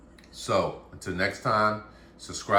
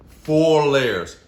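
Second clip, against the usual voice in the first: louder, higher, bursting with pride; excited outburst; 6 semitones higher, 8 dB louder than usual. Real, not acted. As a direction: shout it out thrilled. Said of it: "I get excited about this".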